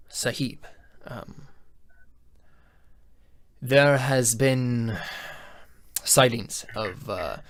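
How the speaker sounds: slow, deliberate servant addressing his lord; measured court advisor voice, drawn out; slow formal advisor. Acted. NPC advisor speaks to lord.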